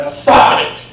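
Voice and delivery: loud burst of an order; retold sharp yell. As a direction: re-enact yelling at yourself to quit. Re-enacts inner yell at himself.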